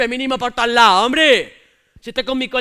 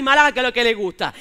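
ordinary talk, nothing marked

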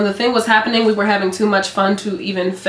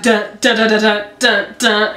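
punchy "dun" beats; vocalized orchestra hits; imitated musical intro. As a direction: mimic the show's opening notes in bursts. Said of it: "'Cause it was like".

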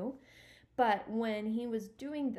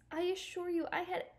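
trembling voice; 8 semitones higher than usual. Acted. Stuart to Harriet over his ruined canoe, trembling voice. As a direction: say it small and trembling. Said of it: "said Stuart in a trembling voice".

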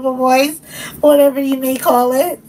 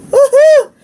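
much higher and louder whoop; a thrilled, high celebratory woohoo; excited cheer; 7 dB louder than usual. Real, not acted. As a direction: cheer it out with joy. Cheering about the box.

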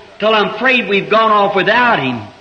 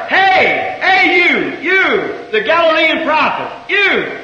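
mocking holler; 6 semitones higher, slower than usual. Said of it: "he heard somebody holler".

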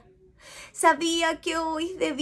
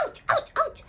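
squeaky little cries of pain; high squeaky ouch. Acted. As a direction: yelp like a tiny bee. Bees getting hurt.

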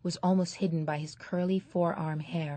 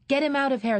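higher, louder angry yell; angry shout; 8 semitones higher, 6 dB louder, faster than usual. Acted. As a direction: throw the kid out now. Angry audience ejecting Esther.

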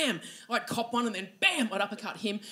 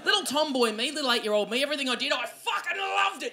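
ordinary delivery

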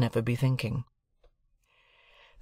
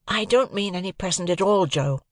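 a lightly voiced boy, higher; earnest young lad protesting; young Pip; 7 semitones higher than usual. A narrator's reading. Pip correcting Joe about the present, a lightly voiced boy.

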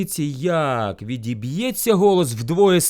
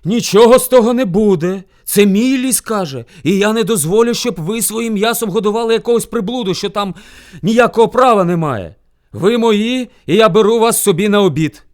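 loud, raised, bossy declaration; domineering bear shout; 5 semitones higher, 9 dB louder than usual. A narrator's reading. Rival bear claims hares for lunch, domineering shout. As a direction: lay down the law forcefully. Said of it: "крикнув він".

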